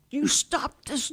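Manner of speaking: high, slow little peacemaker scolding; mock scolding child. Little girl scolding kids to stop fighting, high and slow. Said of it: "I would start hitting them like".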